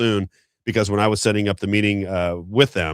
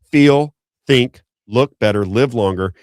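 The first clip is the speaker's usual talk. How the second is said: punchy slogan; 3 semitones higher, 6 dB louder, slower than usual. Real, not acted. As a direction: sell the tagline hard.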